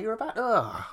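high, pulled-back disgust; disgusted groan. Real, not acted. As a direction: recoil in disgust. Disgusted reaction.

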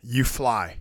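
slow, hushed inspirational reading; soft, slow recital of a famous quote; solemn quote. Pretend. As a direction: read it like a motivational poster. Dramatic reading of Jordan quote.

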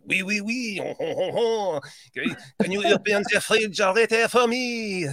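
cartoonish French accent with a chuckle. Shown as a demonstration. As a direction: put on a playful mock French accent. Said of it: "in a French accent".

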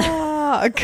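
squealed "rock!"; high shouted lyric. Retold or imitated. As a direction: yell it high and bright.

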